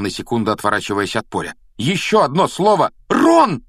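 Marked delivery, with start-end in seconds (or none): 1.8-2.9 raised, heated warning; angry threat. A narrator's reading. Ron warns Malfoy one more word, angry threat. 3.1-3.6 loud, high-pitched cry; sudden exclamation. A narrator's reading. Hermione suddenly cries out to Ron, urgent exclamation. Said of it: "вдруг воскликнула Гермиона".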